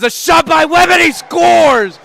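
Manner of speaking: shouting the goal, loud and high; goal call shout. Real, not acted. Calling a goal.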